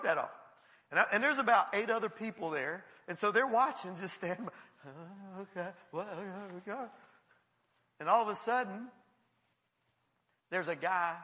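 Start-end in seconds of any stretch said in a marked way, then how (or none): 4.9-6.8 soft, uneasy little murmurs; hushed bystanders. Acted. Eight people watching the deliverance, hushed reaction. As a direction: play onlookers quietly going along.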